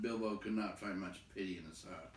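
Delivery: ordinary delivery, nothing marked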